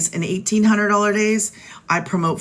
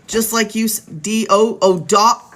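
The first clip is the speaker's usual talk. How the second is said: usual speaking voice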